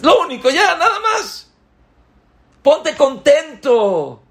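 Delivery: muy alto y fuerte, lento; anímalos con euforia; exhortación exaltada. Acted. Exhortación intensa. (el predicador anima a la congregación a alegrarse, muy alto)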